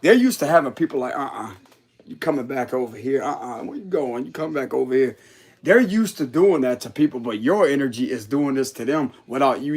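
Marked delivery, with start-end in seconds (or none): 1.1-5.2 a lightly voiced bossy partner, lower and softer; mock controlling partner. Mimicking a controlling partner ordering someone back, lightly voiced. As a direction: imitate someone calling a person back, low.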